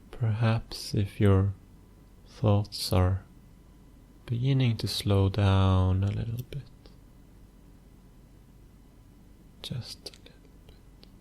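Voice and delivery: soft, unhurried meditation voice with long pauses; hushed, even delivery, letting phrase ends fade; guided meditation. Acted. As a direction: guide the listener gently into stillness, phrase by phrase.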